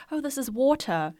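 put-on "water" in a South African accent, the R dropped. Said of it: "And I'd be like".

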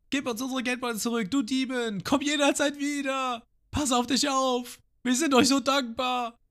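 hoch, langsam hinterhergerufen; rufende Dorfbewohner. Retold or imitated. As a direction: ruf dem Schiff nach. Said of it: "schreien sie". (Dorfbewohner rufen hoch und gedehnt hinterher)

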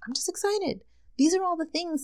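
high squeaky I'm just excited; giddy high-pitched gush; squealing excitement. Real, not acted.

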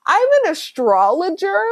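rising, unsure "I'm an astrologer"; said like a question. Pretend. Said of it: "you say it like it's a question".

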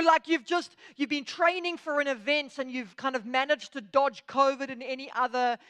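ordinary talk, nothing marked

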